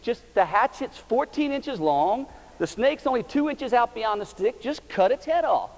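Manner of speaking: high, loud, insistent urging; exasperated pleading. Retold or imitated. Father urging his scared daughter, high and insistent. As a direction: press her to just do it. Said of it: "I said".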